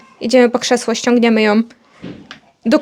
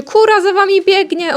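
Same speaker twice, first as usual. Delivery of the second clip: mock shout in a high pitch; high retold call, only mildly loud; grandma calling out; 7 semitones higher, 3 dB louder than usual. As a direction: imitate grandma calling after them. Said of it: "babcia krzyczy".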